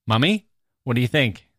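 usual speaking voice

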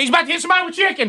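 high, rushed warning; quick warning. Retold or imitated. Grandpa warning about flying chicken, fast and high. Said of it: "I say".

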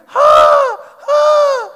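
put-on high loud child's yelps; pained yelp. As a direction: imitate the boy yelping in pain. Son hurt on tailbone.

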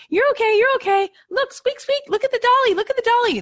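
put-on very high cooing voice over a crying baby; mock sing-song mom. Mom hushing her crying baby, in a high mock coo. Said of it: "And if the mom says".

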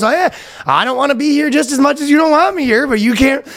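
high mock Canadian bickering; grudging put-on Canadian accent. Feuding pair forced to share a vacation, Canadian accent. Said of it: "the most Canadian interaction".

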